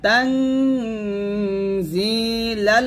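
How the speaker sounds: slow drawn tajweed chant; Quran recitation. Shown as a demonstration. Teacher reciting for students to follow. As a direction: recite slowly, stretching each vowel.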